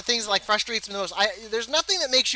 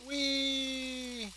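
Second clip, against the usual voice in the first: playful whee; 6 semitones higher, 10 dB quieter than usual. Real, not acted. Jumping off a cliff.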